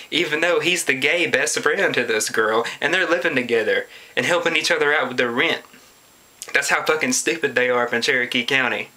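ordinary talk

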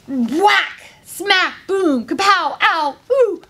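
punchy comic-book blows; fight sound effects. A narrator's reading. Lionesses attack lion, punchy impact noises. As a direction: fire off each hit separately, with force.

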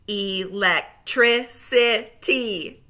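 slow, split into syllables; Electricity said slowly, each syllable apart; segmented syllables. Shown as a demonstration. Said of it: "say the syllables in segments".

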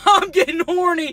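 giggly, loud repeat; laughing. Real, not acted. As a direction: repeat it cracking up.

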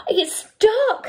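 high, excited shriek. A narrator's reading. Cat and squirrel finding Duck at home, shrieking. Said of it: "they shrieked".

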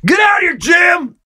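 high, loud comic holler; mock angry yell. Musket shooter yells at his victim, mock angry yell. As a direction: holler it across the street.

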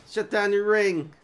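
loud, high demand; reading a line as a shout. Acted. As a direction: bark the order at the gang boss. Reading the hero's line aloud.

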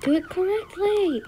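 high, loud exasperated yell; frustrated shout. Real, not acted. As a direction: yell it at the screen. Yelling at game.

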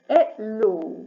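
hello said with no H sound, opening on the vowel; French hello, silent H. Shown as a demonstration. A teacher repeating hello to show the silent H. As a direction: drop the H in hello completely. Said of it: "Літера H не вимовляється ніколи".

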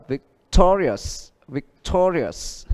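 vic-TO-rious said slowly, weight on to; slow down and lean on to in victorious; teacher model of victorious; stress on to. Shown as a demonstration. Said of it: "nhấn trước nó một âm".

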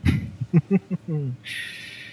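a long knowing laugh that falls away; smug chuckle. Acted. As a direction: laugh at them, you've already won. Taunting captive, amused.

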